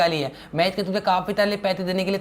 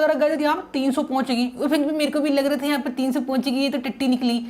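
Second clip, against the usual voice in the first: childish cartoon-boy voice, a little higher; Sinchan voice; 6 semitones higher than usual. Sinchan teasing his buddy Franky, in a childish cartoon voice. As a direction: play the cheeky cartoon kid.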